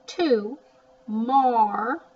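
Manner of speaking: To, mar sounded out loud and high; loud syllable-by-syllable To, mar; sounding it out. Shown as a demonstration. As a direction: decode To then mar syllable by syllable. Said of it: "looks like more".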